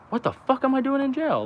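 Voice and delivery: sudden high-pitched panicked outburst; the moment it hits you where you are; shocked disbelief. Retold or imitated. Shock of realizing he's in jail.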